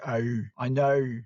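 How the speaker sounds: slow Australian vowels, "Oh, I know" with a long rounded O; Australian accent, slow and deliberate. Shown as a demonstration. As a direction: stretch the O the Australian way. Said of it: "also the long O sound".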